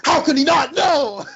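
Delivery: mock-outraged Hugh Jackman; quoting Jackman. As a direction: fling it out in disbelief, high and loud. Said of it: "Hugh Jackman goes".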